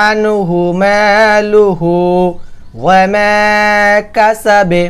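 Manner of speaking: slow chanted verse with long মালুহু; recitation demo. Shown as a demonstration. Teacher demonstrating heavy গয়ন in recitation.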